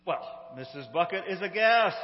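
ordinary talk

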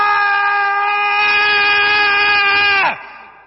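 long, high, loud possessed howl; demon howl. Acted. Possessed man howling in the synagogue. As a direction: hold a wild possessed howl.

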